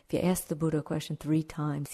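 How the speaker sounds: ordinary talk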